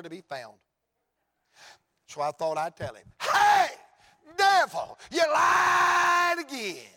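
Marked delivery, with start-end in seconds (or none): 3.2-6.9 high, full-force shout with long stretched words; taunting shout. Acted. Preacher telling off the devil, taunting triumphant shout. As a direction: holler it in triumph at an enemy.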